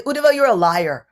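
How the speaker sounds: loud indignant accusation; accusing. Acted. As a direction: throw the charge at him. Brijabasis accuse Uddhava.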